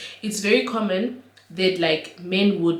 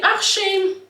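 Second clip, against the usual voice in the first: high pitying sigh; 12 semitones higher, 4 dB louder than usual. Retold or imitated. Sudden pity.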